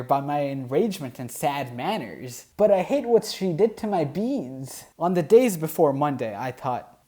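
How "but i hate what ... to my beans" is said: hammed-up hurt, pushed higher on hate; mock-furious about the beans; mock outrage.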